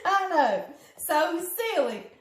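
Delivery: laughing. Real, not acted. Laughing, high and loud.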